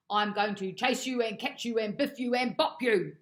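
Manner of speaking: loud, rhythmic dog threat; a cross puppy yelling threats; yelled threat. Acted. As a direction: yell it, hitting each and. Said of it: "yelled Bob".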